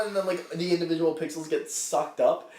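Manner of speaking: normal talking voice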